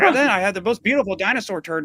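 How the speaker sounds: high, excited voice of a dull rambler; mock the guy telling a pointless story; rambling storyteller. Comic retelling George Carlin's rambler, imitated high and excited. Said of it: "he's imitating somebody who".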